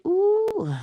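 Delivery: high, drawn-out squeal of delight; excited. Acted. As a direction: let out a thrilled high coo. Acts out getting excited.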